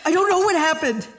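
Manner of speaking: high, shaky near-crying plea; distraught explanation. Acted. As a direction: break down explaining. Husband breaking down.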